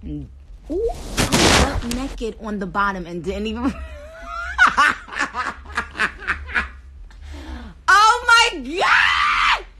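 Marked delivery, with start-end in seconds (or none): 3.7-7.8 long, high burst of real laughter; high laughing fit. Real, not acted. Streamer laughing hard after exposing herself on her live. 7.9-9.6 loud, very high drawn-out scream; mortified shriek. Real, not acted. Streamer realising viewers saw her bare, shrieking loudly. Mortified at being seen undressed.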